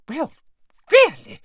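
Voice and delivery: high, loud huff of indignation; affronted. A narrator's reading. Madam Malkin after rude customers storm out, affronted. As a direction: exclaim it, scandalised and high-pitched.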